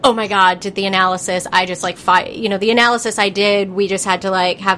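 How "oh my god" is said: ordinary talk, nothing marked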